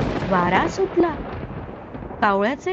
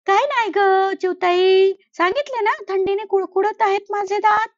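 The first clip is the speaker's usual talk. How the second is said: lightly higher, innocent-sounding crow; fibbing crow; 7 semitones higher than usual. Acted. Crow lies to the sparrow about the crunching, mild innocent tone. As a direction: cover it up, play innocent.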